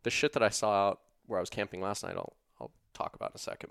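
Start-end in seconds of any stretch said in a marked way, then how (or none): 2.2-3.7 low, fast throwaway aside under the breath; mumbled fast aside; quick hushed aside. Real, not acted. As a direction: toss it off quietly, fast. Quick aside mid story.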